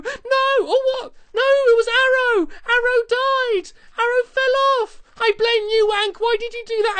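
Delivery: high, loud wail of dismay; distraught cry. Real, not acted. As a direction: cry out that your dog is dead. Dog died, shocked and blaming.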